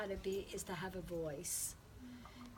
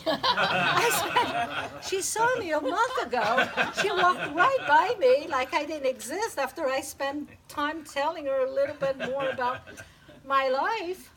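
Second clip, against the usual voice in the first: laughing through it; 6 semitones higher, 13 dB louder than usual. Real, not acted. Laughs while retelling outrage.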